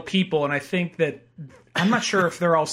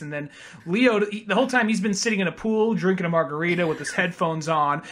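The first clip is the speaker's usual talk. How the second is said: ordinary talk, nothing marked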